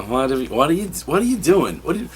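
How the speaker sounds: high-pitched, repeated incredulous questioning; hammed-up bewildered stammer; baffled reaction. Reader baffled by rebooted origins, high mock disbelief. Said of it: "making me go".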